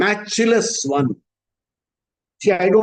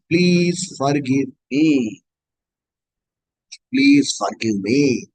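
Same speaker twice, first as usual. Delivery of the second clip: humble plea; 7 semitones lower, much slower than usual. Acted. Humble plea to Krishna, low.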